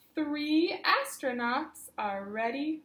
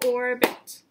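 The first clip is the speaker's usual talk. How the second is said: "Orbit" said loud in two clapped beats, OR-BIT; clapped syllables; 7 dB louder than usual. Shown as a demonstration. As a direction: clap each part of "Orbit" out loud. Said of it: "Let's clap that word".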